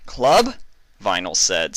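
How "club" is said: much higher, louder sharp question; startled yelp; sudden surprise. A narrator's reading. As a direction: jolt awake at the news. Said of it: "snapping to an upright position".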